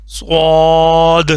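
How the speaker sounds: صاد said thick and heavy, slow and stretched; thick saad. Shown as a demonstration. Teacher showing students a heavy صاد, slow. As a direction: make صاد full and heavy, not like سین. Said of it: "موٹا کر کے پڑھنا ہے".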